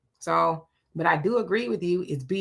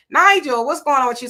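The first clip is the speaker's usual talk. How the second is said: high, loud burst of a hello; a big, loud, happy hello; excited greeting; 5 semitones higher, 6 dB louder than usual. Real, not acted. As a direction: call his name out with real delight.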